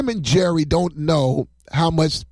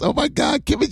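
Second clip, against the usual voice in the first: embarrassed squeal; 10 semitones higher than usual. Real, not acted. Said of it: "I'm embarrassed".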